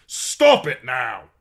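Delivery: loud, slow furious command; angry order. A narrator's reading. Captor shaking the girl, slow furious command. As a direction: hammer each word down.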